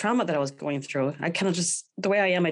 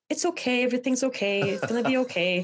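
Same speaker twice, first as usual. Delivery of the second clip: consoling; 7 semitones higher than usual. Retold or imitated. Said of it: "I remember consoling them".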